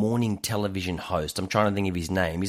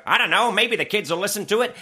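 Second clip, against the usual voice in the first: clueless exec voice; 9 semitones higher, 4 dB louder than usual. Retold or imitated. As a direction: play the clueless label exec. Exec quoted dismissively.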